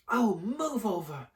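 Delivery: high, slow impatient snap; snarled. Acted. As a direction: shove him aside. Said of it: "Hermione snarled".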